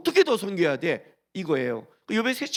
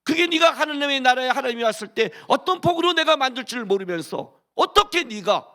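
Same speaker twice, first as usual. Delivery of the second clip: high, loud pulpit declamation; fiery preaching; 6 semitones higher, 5 dB louder than usual. Acted. Preacher scolding the congregation for complaining, fiery loud preaching. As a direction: drive the point home to the crowd.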